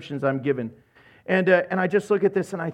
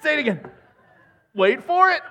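higher, eager calls; excited God calling; 7 semitones higher, faster than usual. Retold or imitated. God calls angels to hear the prayer, excited. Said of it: "To which he goes".

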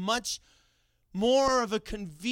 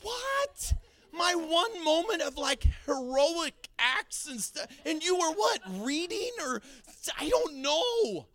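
high-pitched incredulous protest; drawn-out, wounded-hero outburst, played for laughs; mock indignation; 10 semitones higher, 3 dB louder, slower than usual. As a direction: squeal it in exaggerated disbelief. Acts indignant reply to oblivious wife.

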